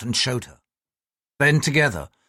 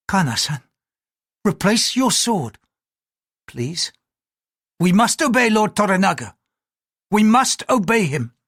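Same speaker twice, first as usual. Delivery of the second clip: high, urgent entreaty; pleading noblewoman; 7 semitones higher, 3 dB louder than usual. Acted. Mariko begging Kana to put away his sword. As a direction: beg him to sheathe the sword.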